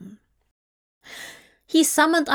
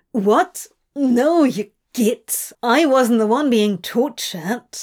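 indignant, louder retort; spat out; 2 semitones higher, 4 dB louder than usual. A narrator's reading. Crowley wrestling out of the angel's embrace, spat out. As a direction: spit it out in indignant denial. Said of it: "Crowley spat".

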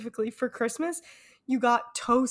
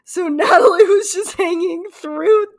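high, loud, crying with laughter; laughing to tears; 9 semitones higher, 10 dB louder than usual. Real, not acted. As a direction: gasp it out through helpless laughter. Retelling fall, helpless laughter.

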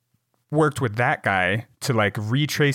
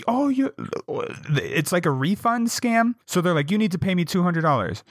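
high, pushy mock scammer voice; mock scammer yell; 8 semitones higher than usual. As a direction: play the aggressive caller, pitched up. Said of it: "They start, like, yelling at them".

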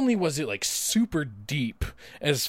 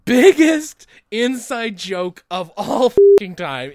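loud, high, slow emphatic rave; 7 semitones higher, 11 dB louder, slower than usual. Real, not acted. Praising the Superman casting, loud slow emphatic rave. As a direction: lean hard on every word. Raving about Cage as Superman.

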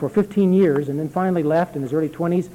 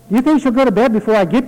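put-on a quick, higher, anxious little boy's question; anxious child; 7 semitones higher, 6 dB louder, much faster than usual. A narrator's reading. Boy on a horse at night asking the servant, anxious and quick. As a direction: ask it fast and worried, like a child in the dark. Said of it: "I asked Caesar anxiously".

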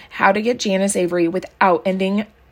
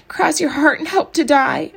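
put-on insistent child; 7 semitones higher than usual. A narrator's reading. Maybelle demanding promise.

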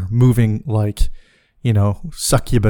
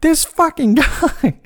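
retold yell; slower than usual. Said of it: "I heard her yelling".

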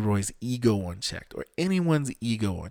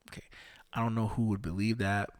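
ordinary delivery

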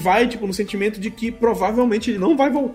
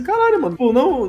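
much higher, shocked exclamation; 5 semitones higher than usual. Retold or imitated. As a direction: voice jumps up in disbelief. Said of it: "Eu fiquei assim". (espanto bem mais agudo)

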